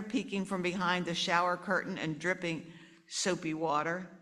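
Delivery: ordinary delivery, nothing marked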